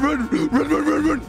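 ordinary delivery

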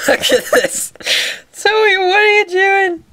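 loud, high laughing exclaim; excited. Real, not acted. Owner laughing at her dog Zoe, loud high call.

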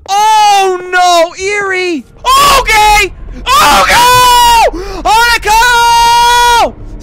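high panicked shrieks; terrified screaming. Real, not acted. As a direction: scream in real terror. Jump scare in horror game.

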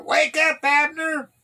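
loud, high call to wake a sleeper; high-pitched wake-up holler; shouting down. A narrator's reading. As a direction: shout it across to the far camp. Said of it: "then he shouted down to the soldiers".

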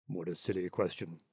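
put-on low, dismissive old rat; old water rat. Water rat scoffing at the linnet, low dismissive voice. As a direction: brush the question off low and scornful. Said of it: "cried the water rat".

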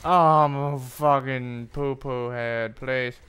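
loud, slow whiny confession; hammed-up crybaby plea; mock pleading. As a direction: ham up a sulky grovel. Mock self-insult.

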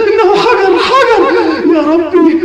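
high, loud, frightened; horrified cry. Acted. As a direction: cry out in horror at the stone. Horror at finding stone. (صرخة فزع عالية)

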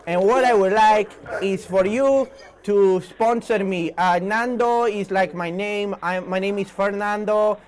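put-on slow, careful words with a light Spanish accent; slow Fernando Torres. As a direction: spell it out slowly for a bad line. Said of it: "I speak slowly for you".

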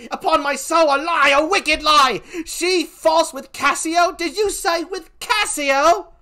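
high, loud, impassioned denunciation; outraged Emilia. Acted. Emilia answers the slander of her mistress, high and outraged. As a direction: cry out in indignant disbelief.

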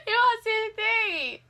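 high, loud laugh into slow tearful shout; ecstatic laughing cry. Real, not acted. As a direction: burst into laughter over a lucky guess. Overjoyed guess was right. (risada eufórica, aguda e alta, virando grito lento)

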